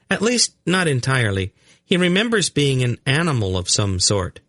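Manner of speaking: put-on high, light boy's voice; high-pitched, unruffled youngster; matter-of-fact boy. A narrator's reading. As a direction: explain it as an innocent child. Narrator voices the blond boy.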